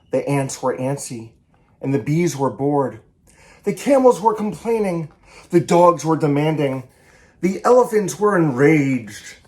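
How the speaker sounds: lively picture-book read-aloud, each animal line acted; story reading. A narrator's reading. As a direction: read it to children with bounce and play. Author reads picture book aloud.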